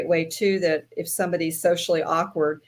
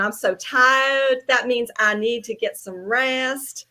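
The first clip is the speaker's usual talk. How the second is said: slow Southern drawl, lifted in pitch; playful, hammed-up Southern drawl; exaggerated Southern accent; 4 semitones higher, slower than usual. Shown as a demonstration. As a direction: drag each word out in thick Southern. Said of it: "more slow and talk even more Southern".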